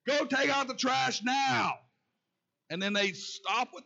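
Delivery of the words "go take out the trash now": high, loud shouted command; yelled order. Retold or imitated. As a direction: done being nice, yell it at the kid. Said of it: "you didn't have to yell".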